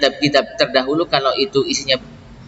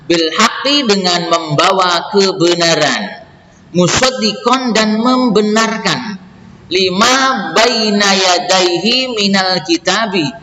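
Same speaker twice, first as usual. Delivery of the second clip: raised, slow, measured recital of verse and gloss; verse reading; 5 semitones higher, slower than usual. Acted. Teacher reading scripture and translation for students, slow measured recital. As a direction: read each phrase deliberately in a lifted voice.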